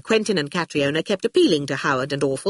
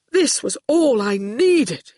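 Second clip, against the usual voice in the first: slow, higher, emphatic complaint; exasperated proclamation; 6 semitones higher, slower than usual. A narrator's reading. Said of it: "he proclaimed".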